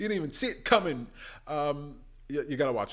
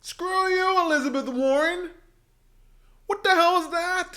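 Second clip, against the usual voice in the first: 1 outraged yell; 12 semitones higher, 4 dB louder than usual. Real, not acted. Outraged reaction to debate clip.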